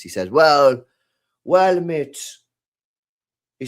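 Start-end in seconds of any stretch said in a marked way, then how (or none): 0.3-2.2 loud attempt at an Irish accent; badly faked Irish pub talk. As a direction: do a clumsy Cork barman accent. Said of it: "It's just a terrible accent".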